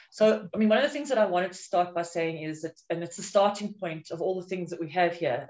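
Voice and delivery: ordinary talk, nothing marked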